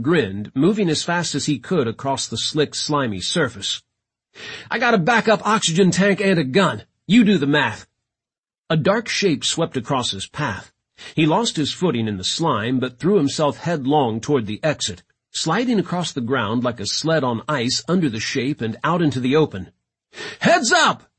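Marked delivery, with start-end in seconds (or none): 4.7-7.9 raised, higher-pitched reply; calling back. A narrator's reading. London explaining his plan to boss on the run, calling out. 20.4-21.1 very high, loud alert; warning shout. A narrator's reading. London sliding out to the others, shouting a warning. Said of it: "he shouted".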